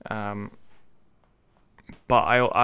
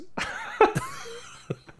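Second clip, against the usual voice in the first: high-pitched laugh; a real burst of high laughter. Real, not acted. Friends joking about odd plurals, laughing high. Laughing at their own wordplay.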